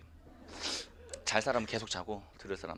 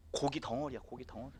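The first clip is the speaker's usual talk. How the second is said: ordinary talk, nothing marked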